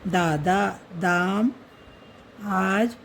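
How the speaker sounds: दादा drawn out slowly, each syllable clear; slow teaching demo. Shown as a demonstration. Teacher slowly sounding out दादा for the class. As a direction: spell out दादा slowly for students. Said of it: "ये बड़ा आ की ध्वनि है".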